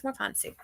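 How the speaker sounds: "fancy" said as FAHN-see, softly; mock-refined "ah" in "fancy"; fancy-sounding. Shown as a demonstration. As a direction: say "fancy" with a broad "ah". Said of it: "makes them sound more fancy".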